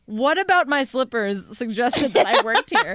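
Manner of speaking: half-laughing, mock-offended disbelief; incredulous laugh. To the shopper who thought she worked there, laughing in disbelief. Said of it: "And I was like".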